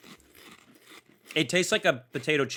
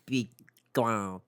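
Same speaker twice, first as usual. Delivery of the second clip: attempted French accent; 3 semitones lower, 5 dB quieter than usual. Shown as a demonstration. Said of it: "I do not know how to do a French accent".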